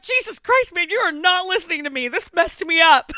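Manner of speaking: exasperated Louis. Retold or imitated. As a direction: throw up your hands, fast and high. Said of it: "He's like".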